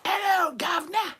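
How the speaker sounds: high, loud Cockney holler; mock Cockney. Mocking how Hollywood does the British, hammed-up Cockney shout. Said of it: "it's fucking Cockney now".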